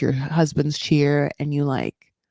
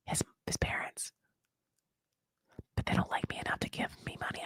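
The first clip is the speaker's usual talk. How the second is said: very soft, confiding whisper; whispered aside; 14 dB quieter, faster than usual. Acted. Wife voicing doubts about his parents' money, whispered aside. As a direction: whisper it like a secret.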